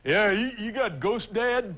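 put-on dimwit asking slowly; dumb guy. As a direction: drag it out like a clueless oaf. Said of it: "I said sort of loudly, and as a dumb guy".

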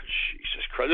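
whispered, weary exasperation; exasperated sigh. Real, not acted. Host sighing in exasperation at the chat. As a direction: breathe it out, fed up. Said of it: "exasperated sigh".

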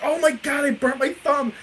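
high-pitched retold panic; mock pained outcry. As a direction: cry out as the burnt friend. Friend acted out after burning thumb.

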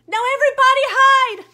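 urgent scream. Acted. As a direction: scream the warning. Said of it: "I screamed".

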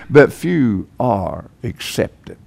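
a grave, slow near-murmur; hushed punchline. Acted. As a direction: drop the voice and slow down for the twist. Punchline after many are invited.

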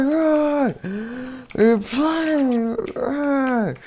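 high, tearful cries to flee; panicked crying. Acted. Animatronic crying at the children to run, panicked. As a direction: sob out a desperate warning to run.

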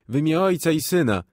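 loud, high startled prayer; frightened prayer. A narrator's reading. A frightened policeman crying out a prayer. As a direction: blurt the prayer in sudden fright. Policeman crosses himself in fear.

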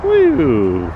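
loud, high whoop; cheering. Real, not acted. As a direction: whoop for the splash. Cheering stone throw.

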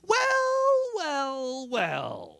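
a high squeal on the first well, then dropping; sing-song gloat sliding down from very high; high, falling mock gloat. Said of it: "She goes".

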